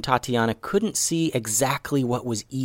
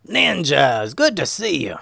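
raised, drawn-out character voice; Momo's hearty greeting; 4 semitones higher, slower than usual. Acted. Momo welcomes the returning ninjas, hearty character voice. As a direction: greet them big and jovial.

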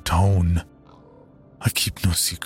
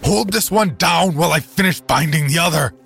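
higher, louder shouted command; shouted order; 8 semitones higher, 5 dB louder than usual. Acted. Reverend shouting to the father mid-struggle, loud order. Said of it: "he shouted".

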